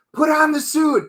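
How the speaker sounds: high, urgent command; high-pitched mock urging from the wife; insistent wife. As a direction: push him to suit up.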